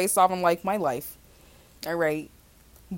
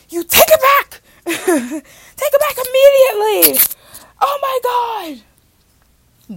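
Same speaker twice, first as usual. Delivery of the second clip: much higher, louder, laughing demand to take it back; hammed-up outraged shriek; 8 dB louder than usual. Reenacting a fight with her therapist, a laughing mock shriek. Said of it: "I am doing a reenactment".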